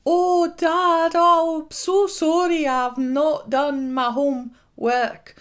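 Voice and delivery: high, loud mock Scottish girl, slowing at the end; Scottish schoolgirl wailing over homework, hammed up; Scottish accent. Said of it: "I can give you a sense of the Scottish accents".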